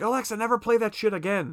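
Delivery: high-pitched mock telling-off; mock scream. Melinda lays into her over Alexa, mock scream. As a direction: retell her outburst, pitch jumping up. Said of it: "Melinda is screaming at her".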